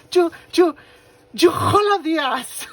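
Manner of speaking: hammed-up angry yell, stuck for English words; imitating husband's road rage. Imitating her husband yelling at a driver who cut him off, stammering furious shout. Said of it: "trying to curse him out in English".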